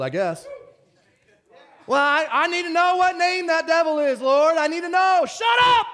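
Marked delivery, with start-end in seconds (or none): 1.9-5.2 high, louder mock voice of a fussy believer; a touch of mockery as he parrots the seeker; mocking whiny believer. Preacher parodying a congregant demanding the devil's name, mocking. 5.3-5.9 high, loud shout; sharp shout. Real, not acted. Preacher cutting off stupid theology, shouting. Preacher rebukes the mocked view.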